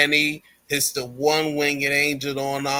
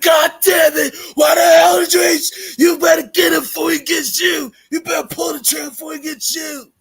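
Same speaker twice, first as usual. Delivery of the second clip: much higher and louder, fast enraged yelling; furious shout; 10 dB louder, faster than usual. Retold or imitated. Furious wrestler yelling at his partner, much higher and louder. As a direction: scream at your partner for the mistake. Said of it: "Mox was freaking pissed".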